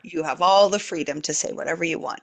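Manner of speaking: usual speaking voice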